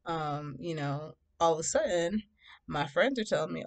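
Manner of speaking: ordinary delivery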